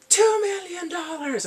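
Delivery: very high, loud, slow declaration; showy, triumphant bid; grand bid. Retold or imitated. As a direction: announce the huge bid with flair. And then he's like this.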